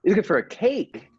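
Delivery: can't believe she said cake; surprised repeat. Acted.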